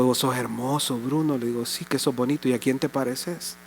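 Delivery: soft, low cooing to his grandson; tender words to a baby. Retold or imitated. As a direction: hush it like soothing a crying baby. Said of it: "lo que le empiezo a decir es cuánto yo lo amo". (susurro tierno y suave a un bebé)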